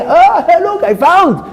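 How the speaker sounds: high, loud mock delight; hammed-up squeal over a lucky find; gleeful finder. Acts out people pleased with finds.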